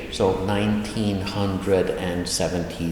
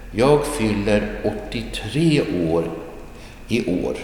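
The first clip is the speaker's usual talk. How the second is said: careful model reading. Shown as a demonstration. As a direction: stretch each word, pausing before the end. Teacher models slowly, then repeats naturally.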